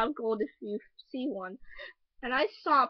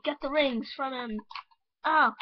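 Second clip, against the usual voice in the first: ordinary talk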